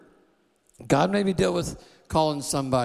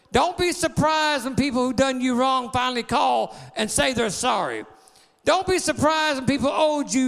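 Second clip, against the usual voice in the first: rising, shouted preacher cadence; a pastor rallying the church against the devil, preaching hard; full-force preaching; 7 semitones higher than usual. Acted.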